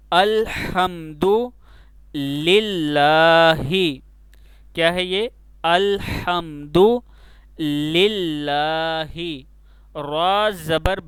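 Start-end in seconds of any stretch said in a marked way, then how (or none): 0.1-4.0 slow loud recitation. Shown as a demonstration. Teacher recites the verse aloud. 5.6-9.5 slow loud recitation. Shown as a demonstration. Teacher recites the verse again.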